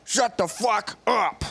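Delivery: hammed-up exasperated silencing; mock furious retort. Owner silencing his talkative penis, mock fury. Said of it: "what I would do to my penis".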